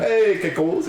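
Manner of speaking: high, loud cartoon Italian; Mario-style Italian accent. Imitating a sister trying to be understood in Italy, Mario-style Italian accent. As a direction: exaggerate the Italian like a video-game plumber. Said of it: "made it sound like Mario Brothers".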